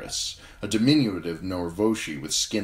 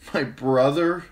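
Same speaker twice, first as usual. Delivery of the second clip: sob-laugh; 9 semitones higher, 6 dB louder, slower than usual. A narrator's reading. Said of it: "Her sob was half a laugh".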